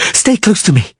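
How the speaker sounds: high, pressing call; urgent call. A narrator's reading. Sorceress shielding them from stones.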